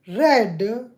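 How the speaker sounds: loud, word by word with long gaps; loud voice command. Real, not acted. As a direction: spell it out for a stubborn machine. Repeats command to failing assistant.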